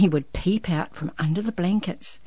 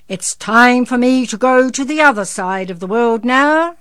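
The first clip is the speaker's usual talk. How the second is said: higher, loud Santa voice; big-voiced Santa; 6 semitones higher, 11 dB louder than usual. A narrator's reading. Santa tells the boy he must go, big-voiced.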